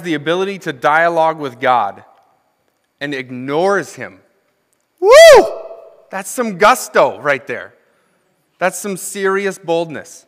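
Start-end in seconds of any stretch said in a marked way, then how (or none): 5.0-5.6 short, very high, loud whoop; mock whoop. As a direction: whoop in amused admiration. Reacting to Jonah's boldness.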